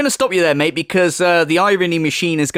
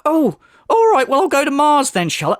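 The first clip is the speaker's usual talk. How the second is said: sarcastic higher retort; 7 semitones higher than usual. Pretend. Sarcastic imagined reply.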